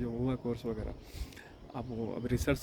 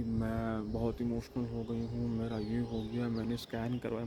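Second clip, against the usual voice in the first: slow, softer, self-pitying mock tone; mock emotional victim; 3 semitones lower, 4 dB quieter, slower than usual. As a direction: drag it out like a fragile victim. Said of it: "उसने बोला".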